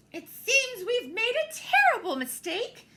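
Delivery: loud, high urgent cry; near shout. Acted. Missus Bulldog nearly shouting in relief at the park. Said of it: "she nearly shouted".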